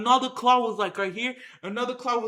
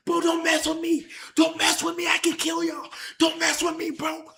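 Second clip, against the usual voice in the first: loud, higher, rough-voiced warning; tough threat; 4 semitones higher, 4 dB louder than usual. Threatening the lion cubs after taking one down, loud higher shout. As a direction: bark it at the cubs to back off. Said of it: "I was like".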